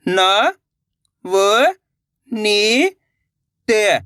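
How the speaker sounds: each syllable said apart, with a pause between; letter-by-letter sounding out. Shown as a demonstration. Teacher sounding out letters for children. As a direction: sound out न, व, नी, त one at a time. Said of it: "देखिए किस तरीके से".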